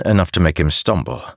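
usual speaking voice

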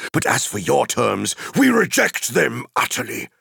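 high, thundering rejection building to a shout; wizard's furious, ringing banishment; defiant cry. Acted. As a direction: dismiss the enemy with mounting wrath, climaxing on the last word. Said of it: "he cried".

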